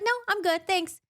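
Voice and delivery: high, quick dismissive Farrah; breezy brush-off. Retold or imitated. Farrah brushing off Tamlin's offer, high breezy dismissal. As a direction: wave him off lightly and flee. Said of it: "She's like".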